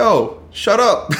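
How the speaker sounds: high, drawn-out mock protest; mock exasperation. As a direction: play a fed-up classmate, dragging it out. Classmate annoyed at the question-asker.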